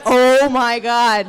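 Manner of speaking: loud, slowly drawn-out mock shock; aghast. Nurse gasping at the awake patient, hammed-up shock. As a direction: imitate a horrified nurse, stretching it out. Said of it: "And she gasps".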